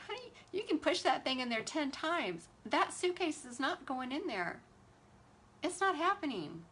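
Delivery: lightly acted "honey, no" reproach; mock exasperated scolding. Imagined scolding of passenger.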